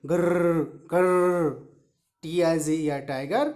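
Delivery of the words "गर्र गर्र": slow rolled-r growls; teacher imitating a tiger. Shown as a demonstration. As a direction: growl grrr like a tiger, drawn out. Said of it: "tiger की आवाज़ कैसे निकलती है".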